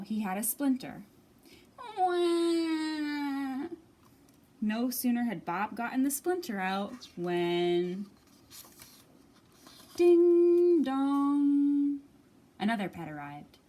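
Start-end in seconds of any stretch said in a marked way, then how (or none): 2.0-3.7 a little bear's high wah of pain; high whining cry sound; high baby wail. Acted. Bear hurt by splinter. 10.0-12.0 loud sung ding dong; loud doorbell imitation; doorbell chime. Doorbell rings.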